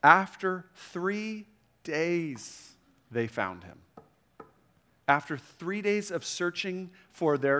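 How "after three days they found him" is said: drawn out slowly with long pauses; slow dramatic reading. Acted. Preacher to moms, slow weighty reading of the key phrase. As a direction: let each word land, slowly and gravely. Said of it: "here's the phrase that hit me".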